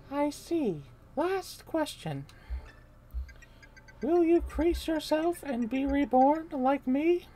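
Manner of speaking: high, very slow and drawn out; eerie princess voice. Acted. Brainwashed Peach questions Mario, high and eerie. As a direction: float it up high, creepy and slow.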